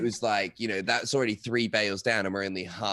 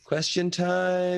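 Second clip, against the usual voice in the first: announcer; 8 semitones higher, much slower than usual. Acted. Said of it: "announcing the Q&A segment".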